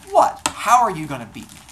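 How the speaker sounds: loud, high-pitched disbelief; incredulous challenge. Acted. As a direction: burst out scoffing at the challenger. God scoffs at her challenge.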